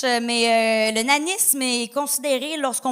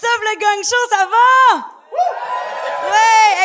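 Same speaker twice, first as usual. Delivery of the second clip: crowd greeting shout; 8 semitones higher, 8 dB louder, much slower than usual. Real, not acted.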